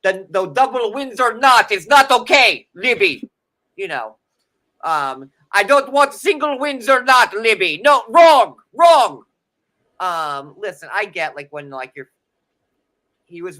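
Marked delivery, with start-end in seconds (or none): 0.0-3.3 loud, high frantic yelling at his wife; hammed-up angry husband; mock frantic rant. Imitating Andre's tie freakout. 5.5-9.2 high, loud frantic shouting; mock furious shout. Continuing Andre impression, shouting.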